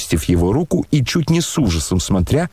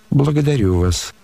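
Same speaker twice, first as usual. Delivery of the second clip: usual speaking voice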